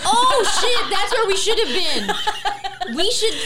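high, loud laughing outburst; laughing exclaim. Real, not acted. Friend jokes they missed the crying, loud laughing outburst. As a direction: laugh it out, half-shocked.

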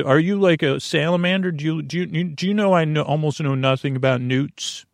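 ordinary talk, nothing marked

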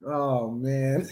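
normal talking voice

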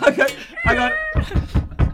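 very high, squeaky, laughing; a high squeak of laughter at the silly role-play. Real, not acted. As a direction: squeal it through giggles. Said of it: "I've got a headache".

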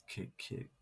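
barely-there murmur of "cute, cute"; whispered. Real, not acted. Repeating cute, now whispered.

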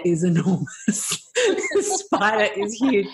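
giggling while saying it; laughing through it. Retold or imitated. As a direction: laugh out the words, amused. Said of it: "And so I was like".

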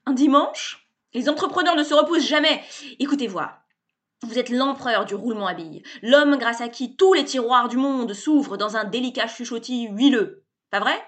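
loud, high, cheerful bluster; brash salesman. A narrator's reading. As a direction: barge in like an overfamiliar hustler. Sébastien's pitch.

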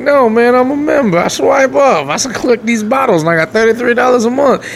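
mock homeless guy. Voicing a homeless man.

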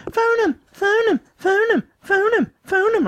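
very high squeaky mock of his partner; high mimicked nagging. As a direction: nag in a high, silly voice. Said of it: "I just had Ash going".

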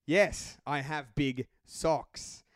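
ordinary talk

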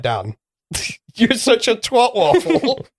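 high, slow, giggling taunt; teasing a friend over a silly bet, laughing through a mock insult. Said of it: "I'd be like".

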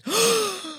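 sudden high gasp; sharp gasp. Real, not acted. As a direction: gasp in delight. Reacts to recipient news.

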